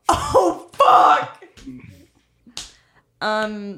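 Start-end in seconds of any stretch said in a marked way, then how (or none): 0.1-1.4 startled shout; loud curse. Real, not acted. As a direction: blurt it out loud and shocked. Sudden loud outburst.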